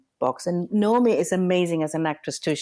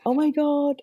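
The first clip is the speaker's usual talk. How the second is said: high excited oh my God; mild mock squeal of joy; thrilled gasp; 10 semitones higher than usual. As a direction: squeal it out in delight. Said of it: "we were like".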